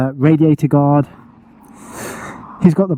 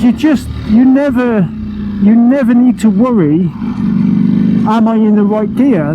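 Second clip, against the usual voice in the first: excited; 7 semitones higher than usual. Real, not acted. Thrilled rider shouting over engine.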